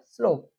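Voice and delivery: स्लोप with a long o and silent e. Shown as a demonstration. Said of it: "उसका उच्चारण ओ हो". (स्लोप में लंबा ओ स्वर)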